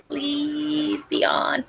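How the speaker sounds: high, soft, slow begging; slow drawn-out plea. Real, not acted. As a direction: stretch it out, begging softly. Repeats the plea more slowly.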